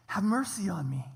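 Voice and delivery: unvoiced whispered begging; whispered plea. Blind man's last plea to Jesus, a hushed whisper. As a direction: breathe the plea out, spent. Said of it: "very quietly in comparison to how he'd just been yelling".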